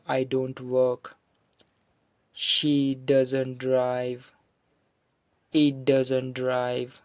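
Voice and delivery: very slow, measured example reading; deliberate teacher's drill delivery; model sentences. Shown as a demonstration. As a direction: space the words out for learners. Teacher reads out model negative sentences.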